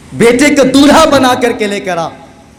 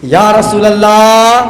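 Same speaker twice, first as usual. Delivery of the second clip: loud, slow drawn-out call; 6 dB louder, slower than usual. Shown as a demonstration. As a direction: call it out loudly and slowly. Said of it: "زور سے".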